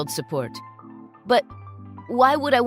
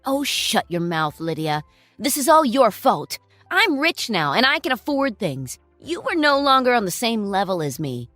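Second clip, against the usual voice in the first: contemptuous; 2 semitones higher, 7 dB louder than usual. Acted. Ex-husband berates ex-wife.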